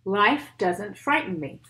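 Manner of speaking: put-on steady rhythmic poem read for kids. Reads poem aloud to children.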